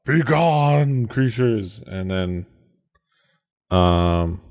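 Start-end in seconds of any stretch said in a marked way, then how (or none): 0.1-1.8 higher, drawn-out mock battle cry; half-hearted scream to banish the creatures. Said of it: "to scream out".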